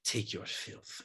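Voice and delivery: breathy whisper, voiceless. Acted. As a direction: hiss it out in a whisper. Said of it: "and she says".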